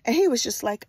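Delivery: plain reading voice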